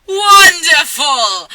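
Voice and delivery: slow, high, loud gush; hammed-up cheerful exclamation; delighted. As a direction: stretch it out in mock delight. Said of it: "Malfoy said".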